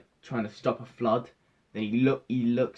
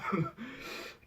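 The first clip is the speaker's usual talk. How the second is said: breathy high giggle; high breathy laugh; 10 semitones higher, 8 dB quieter than usual. Real, not acted. Laughing at Captain Marvel.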